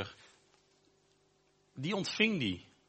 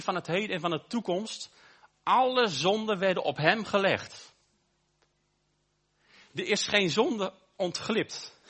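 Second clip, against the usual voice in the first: rising preaching; 4 semitones higher than usual. Acted. Preaches at full force.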